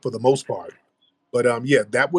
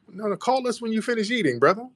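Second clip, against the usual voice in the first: high teasing voice; 10 semitones higher than usual. Pretend. Host mocking caller, high voice.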